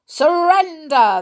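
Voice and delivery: high, loud cry; cried out. Acted. Bears from the chimney crying out to the startled children. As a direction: bark the order at the children. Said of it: "they cried".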